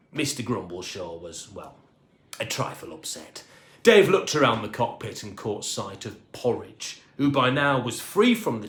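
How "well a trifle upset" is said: usual speaking voice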